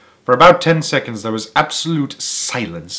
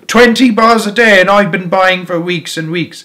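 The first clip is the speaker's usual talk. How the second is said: angry complaint; 7 semitones higher, 6 dB louder than usual. A narrator's reading. Same angry boy complaining.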